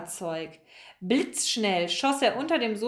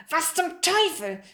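higher, slow, irritated growl; growled curse; 7 semitones higher than usual. A narrator's reading. Other man hears Maxe cry out, growled curse. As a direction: growl it in angry surprise. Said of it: "knurrte der andere Mann".

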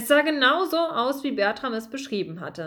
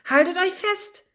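higher, urgent call to hold on; 8 semitones higher than usual. Acted. Bertram calls to his friends on the boat, urgent. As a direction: call out to keep them gripping. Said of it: "rief er".

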